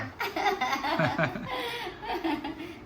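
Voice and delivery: long, high laugh; amused laugh. Real, not acted. Mother amused by child wanting both didi and dada, laughing. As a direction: let a real laugh run on.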